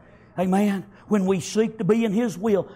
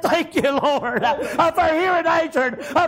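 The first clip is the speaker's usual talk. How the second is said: joyful, laughing prayer of thanks; bubbling over; 7 semitones higher than usual. Retold or imitated. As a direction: pour out grateful joy to the Lord. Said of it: "I was a bubbling over".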